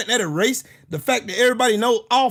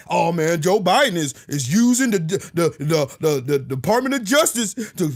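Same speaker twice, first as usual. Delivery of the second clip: imitating Trump; 8 semitones lower than usual. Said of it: "claimed that".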